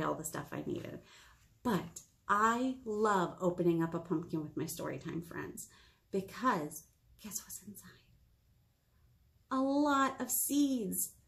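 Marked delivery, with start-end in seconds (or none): 6.1-7.9 a secretive, teasing whisper. Acted. Librarian teasing storytime kids about the pumpkin, whispering.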